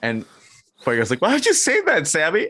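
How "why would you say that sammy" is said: much higher, wailing hurt cry; mock crying. Fuego hurt by Sammy's whisper, in high hammed-up wailing. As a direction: whimper it like a hammed-up wounded wrestler. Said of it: "he starts crying".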